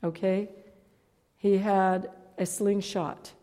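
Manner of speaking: usual speaking voice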